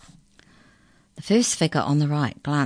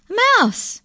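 very high, loud cry of disbelief; astonished exclamation; 7 dB louder than usual. A narrator's reading. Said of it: "exclaimed the incisor".

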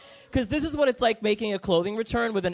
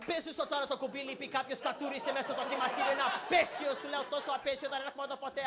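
soft, high, rapid-fire Greek grumbling; imitated Greek mom; 4 semitones higher, 11 dB quieter, much faster than usual. Greek mom to her child at Macy's register, hushed rapid grousing. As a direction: rattle off the complaint under the breath. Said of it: "my mom is just like".

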